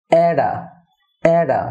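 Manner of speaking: অ্যাডা as one falling word, "AE-da"; linked t as d. Shown as a demonstration. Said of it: "টি হবে ডি". (অ্যাডা, "ট" বদলে "ড")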